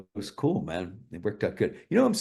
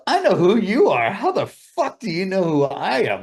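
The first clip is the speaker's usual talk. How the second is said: high, loud startled disbelief; blurting out astonished disbelief; retold amazement; 9 semitones higher, 8 dB louder than usual. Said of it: "I go".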